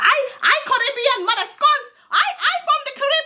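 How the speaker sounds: high-pitched mock Caribbean accent; Daya's Caribbean accent. Reviewer mocking a reality star's accent for viewers, high put-on Caribbean accent. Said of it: "her and her accent".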